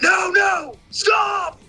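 high yelled warning to the deer; desperate high cry to stop; yelling. Retold or imitated. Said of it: "I yelled".

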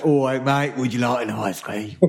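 matey South London ice-cream seller; mock Southeast London accent. Teasing Nico as a London ice-cream seller, mock London accent. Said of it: "I'm Nico from Southeast London".

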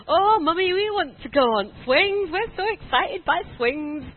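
high, loud impression of eager kids; excited little boys. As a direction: imitate children thrilled, drawing out the end. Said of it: "the boys are so excited".

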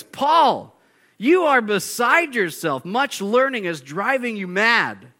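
loud voice. A narrator's reading. Preacher reading Festus rebuking Paul, loud. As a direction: call him out loudly. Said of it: "Festus said with a loud voice".

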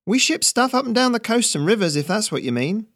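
put-on high, eager young dockhand; young Ferret. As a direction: pitch it up, keen to land the job. Ferret answers the stranger.

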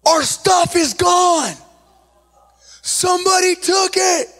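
alarmed report. Retold or imitated. Alarmed brother-in-law reports theft.

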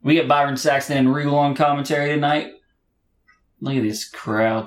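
normal talking voice

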